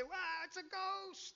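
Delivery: high, slow, startled gasp of fear; spooked disciples crying out in alarm; frightened cry. Retold or imitated. Disciples seeing Jesus on water, high slow frightened cry.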